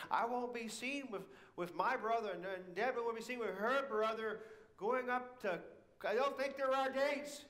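put-on high, loud, indignant teen protest. Sister protesting to Mom, high loud indignant outcry. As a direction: pour on the outrage, high and loud. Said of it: "And she loudly protested".

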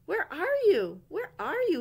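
high, loud frantic calls; frantic cry. Acted. Owl jumping on the bed hunting bumps, crying out. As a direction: cry out searching wildly. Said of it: "he cried".

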